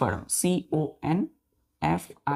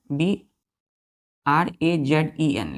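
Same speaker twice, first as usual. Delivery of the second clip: slow spelling; much slower than usual. Shown as a demonstration. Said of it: "teacher spells word for children".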